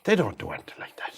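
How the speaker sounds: unvoiced confiding whisper; hushed aside to the viewers. Shown as a demonstration. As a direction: drop to a whisper as if sharing a secret. Repeats the correction as an aside.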